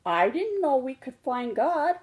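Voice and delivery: a small cub amazed and happy; delighted little cub. Acted. Little cub to Mama, thrilled and amazed.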